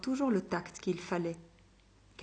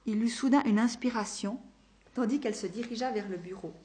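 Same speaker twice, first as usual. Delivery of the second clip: ordinary delivery, nothing marked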